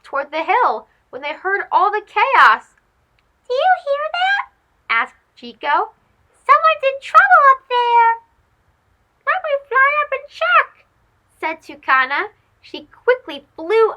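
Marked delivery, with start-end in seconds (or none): 3.5-4.5 high, alert mouse asking; little mouse voice. Acted. Mouse character voice. 6.3-8.1 high, alert mouse asking; little mouse voice. Acted. Mouse character voice. 9.3-10.9 high, eager bird offering to help; toucan voice. Acted. Toucan character voice.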